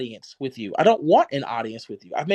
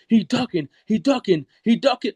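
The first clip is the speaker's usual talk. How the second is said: mocking; 6 semitones higher than usual. Mocking the other's repeated taunt.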